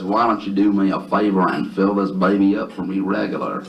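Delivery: heavy, stretched-out Southern drawl; exaggerated Southern accent; thick Southern drawl. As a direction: lay on a thick, slow Southern twang. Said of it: "answers him in a thick, heavy Southern drawl".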